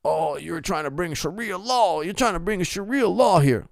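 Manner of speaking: imitating a harasser accusing him of bringing Sharia law, mocking; mocking accuser voice.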